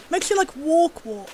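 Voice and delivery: ordinary talk, nothing marked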